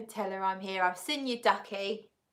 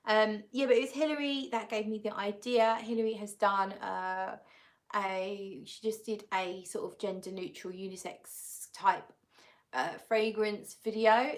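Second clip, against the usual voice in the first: ordinary talk, nothing marked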